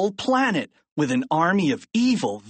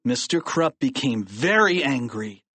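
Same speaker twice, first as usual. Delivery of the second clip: plain reading voice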